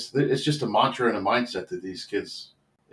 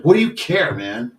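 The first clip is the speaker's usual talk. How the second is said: loud, high, angry retort; pissed off; 6 semitones higher, 7 dB louder than usual. Retold or imitated. Kid lashes out at his coach, loud and angry. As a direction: fire it back at the coach, hurt and defensive. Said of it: "he got pissed".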